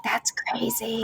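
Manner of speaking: drawn-out whisper of amazement; hushed disbelief. Retold or imitated. Wife reacting to husband's accent matching, in a slow amazed whisper. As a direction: whisper it slowly, marvelling.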